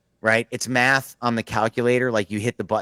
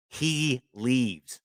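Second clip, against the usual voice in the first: ordinary talk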